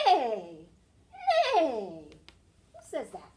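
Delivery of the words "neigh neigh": loud, falling whinny; whinny like a horse, tremulous and descending; horse neigh imitation. Shown as a demonstration. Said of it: "A horse says".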